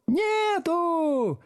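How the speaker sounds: very high drawn-out whoop; jubilant yell. A narrator's reading. Back rows answer teacher, yelling jubilantly. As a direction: yell it gleefully, stretched high. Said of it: "ликующе завопила галёрка".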